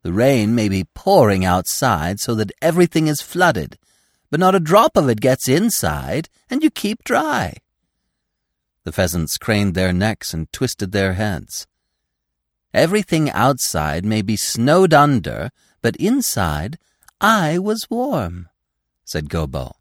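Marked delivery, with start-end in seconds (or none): 0.0-7.6 put-on marvelling young deer, pitch climbing as he goes; awed, animated tale-telling; Gobo telling wonders. 12.7-18.5 put-on marvelling young deer, pitch climbing as he goes; awed, animated tale-telling; Gobo telling wonders.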